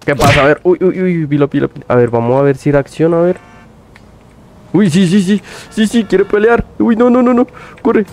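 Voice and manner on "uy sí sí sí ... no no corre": panicked. Real, not acted. Panicking as enemy attacks.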